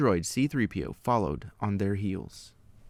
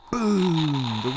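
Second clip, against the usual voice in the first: higher, louder sound-effect word; dramatic boom; 6 semitones higher, 3 dB louder than usual. A narrator's reading. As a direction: punch it like an explosion. Walker explodes.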